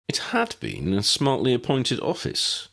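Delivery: ordinary delivery, nothing marked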